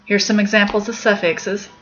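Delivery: normal talking voice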